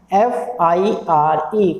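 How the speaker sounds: F, I, R, E slowly, letter by letter; spelled out. Shown as a demonstration. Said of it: "ठीक से पढ़ते हैं". ("एफ़ आई आर ई" धीरे-धीरे अक्षर बोलकर)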